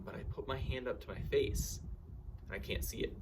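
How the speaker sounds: usual speaking voice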